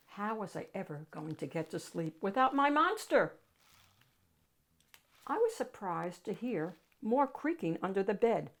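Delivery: usual speaking voice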